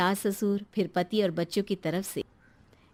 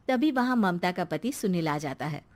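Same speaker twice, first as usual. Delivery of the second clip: ordinary delivery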